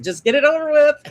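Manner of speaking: retold pained impatience; impatient inner thought. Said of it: "I'm thinking".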